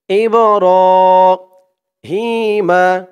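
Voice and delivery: ইব্রাহিমা chanted slowly, হি stretched one alif; slow tajweed model of ইব্রাহিমা; Quran recitation drill. Shown as a demonstration. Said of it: "হি এক আলিফ টান".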